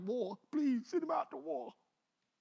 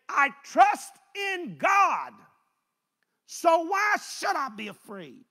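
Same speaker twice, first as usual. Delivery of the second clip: King David declaring; 5 semitones higher, 8 dB louder, slower than usual. Acted. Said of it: "This is David writing this".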